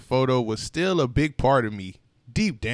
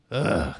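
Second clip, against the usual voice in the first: deep disgusted ugh; low groan; 8 semitones lower, 3 dB quieter than usual. A narrator's reading. Narrator finding a flat tire, groans ugh.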